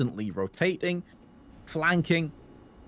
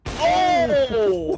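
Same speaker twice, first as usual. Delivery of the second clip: sudden loud high "Oh!"; startled yell at the play; surprised shout; 13 semitones higher, 8 dB louder than usual. Real, not acted. Caster reacts to big play.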